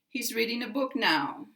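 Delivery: "now" slides down in one glide; brisk line, voice falling through "now". Shown as a demonstration. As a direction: end with a little downward glide on "now". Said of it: "kind of a little glide down".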